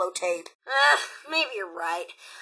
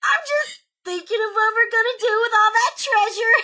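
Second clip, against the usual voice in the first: nervous laugh; 10 semitones higher than usual. Acted.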